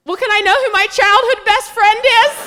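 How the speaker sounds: very high, loud mock wail; exasperated mom. Mom's last words to phone company, high exasperated yell. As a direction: imitate mom yelling in frustration. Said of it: "my mom's last words were".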